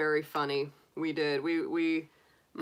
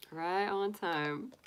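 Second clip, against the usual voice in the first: slow and softer, savouring it; slow soft echo; 7 dB quieter, much slower than usual. Real, not acted. Greeting a viewer who arrived on time, slow soft echo. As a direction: repeat it slowly and quietly, half to yourself. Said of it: "So you're right on time".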